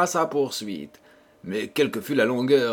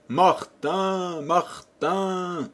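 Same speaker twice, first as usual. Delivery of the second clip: higher, calling out desperately across the field; calling at the top of his lungs; 6 semitones higher, much slower than usual. Acted. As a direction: shout for the lost donkey in despair. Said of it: "il se prit à crier à tue-tête".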